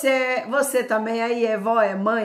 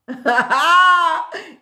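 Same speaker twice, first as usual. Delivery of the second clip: loud, high laugh; 9 semitones higher, 9 dB louder than usual. Real, not acted. Preacher after challenging her listeners, loud laugh.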